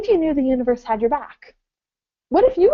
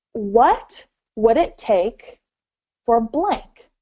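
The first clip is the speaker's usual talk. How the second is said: slow and deliberate; much slower than usual. Shown as a demonstration. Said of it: "So the question is".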